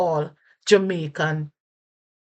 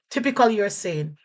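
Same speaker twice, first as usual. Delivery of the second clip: ordinary delivery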